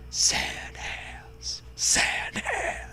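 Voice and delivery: soft unvoiced whisper of sand hands; whispered pun. Narrator teasing the players with a hushed repeat. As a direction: breathe it out like a secret.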